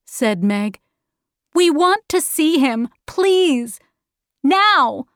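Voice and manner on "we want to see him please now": put-on high, insistent child's demand; impatient girl. Meg demanding to see her father, high impatient pleading.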